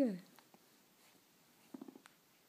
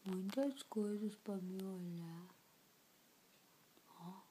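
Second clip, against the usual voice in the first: whispering; 2 semitones lower, 9 dB quieter than usual. Pretend. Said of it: "voicing baby's thoughts".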